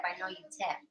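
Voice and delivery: usual speaking voice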